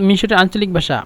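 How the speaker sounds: ordinary talk, nothing marked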